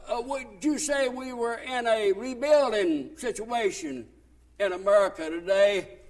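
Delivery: ordinary delivery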